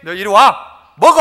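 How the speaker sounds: loud stern summons; calling to come eat. Retold or imitated. God calls the unworthy to the table, loud stern call. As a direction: order them over, then to eat. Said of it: "라고 하는 거예요".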